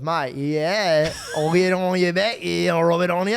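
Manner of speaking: loud, hammed-up Aussie accent; mock Australian cousin. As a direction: play a caricature Australian mate. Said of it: "he will speak like that".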